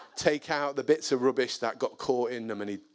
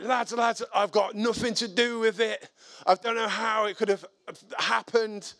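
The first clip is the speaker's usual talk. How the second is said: high, pleading denial; retold protest, pitched well up; protesting innocence; 8 semitones higher than usual. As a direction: imitate a neighbour flustered into denying it. Neighbour protests his innocence.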